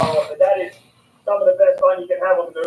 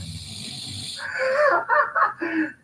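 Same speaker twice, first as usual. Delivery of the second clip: laughing; 4 dB quieter than usual. Real, not acted. Fish struggling in his hands.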